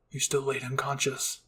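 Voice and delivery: usual speaking voice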